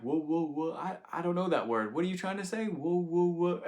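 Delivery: high mock-baffled grandpa; pretending confusion. Grandpa pretending not to know the word work, high mock confusion. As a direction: play dumb like a joking grandpa. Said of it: "he would pretend he didn't know how to say the word work".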